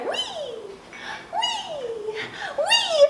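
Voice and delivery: high, gleeful little whee; playful squeal. A narrator's reading. Princess tosses ball playfully.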